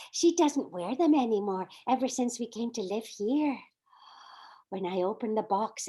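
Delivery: normal talking voice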